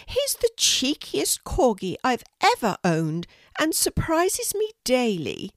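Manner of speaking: a kindly Queen welcoming a guest, pitched well up. Acted. The Queen inviting a shy girl to tea, high regal voice. Queen speaks about Monty.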